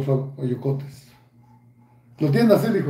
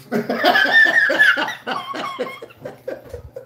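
high mocking laugh. Real, not acted.

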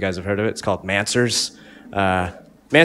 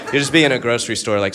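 normal talking voice

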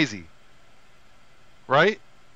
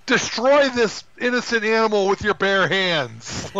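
higher-pitched, half-hearted mock yell; mock screaming; 8 semitones higher, 4 dB louder than usual. Woman urging Hercules to destroy the bear, in a mock yell. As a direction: imitate a woman urging on violence. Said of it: "just screaming".

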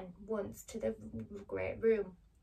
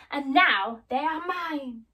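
slow, loud, higher witch's gloat; 5 semitones higher, 9 dB louder, slower than usual. Acted. Witch claims Dorothy's shoes, gloating triumphantly. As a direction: stretch it out in greedy triumph.

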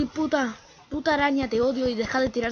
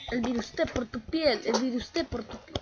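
usual speaking voice

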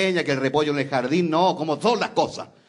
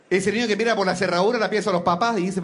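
usual speaking voice

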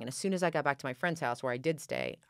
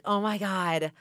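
relieved excitement; 6 semitones higher, 6 dB louder, much slower than usual. Retold or imitated. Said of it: "I was like".

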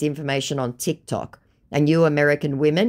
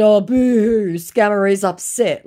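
sarcastic mock sympathy, pitched up; mocking boo-hoo; 7 semitones higher, 8 dB louder than usual. Mocking the upset scammer with fake pity. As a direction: tease the scammer's sulking.

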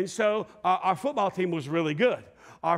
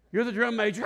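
usual speaking voice

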